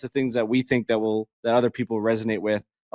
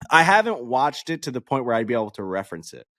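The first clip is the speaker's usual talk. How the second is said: ordinary talk, nothing marked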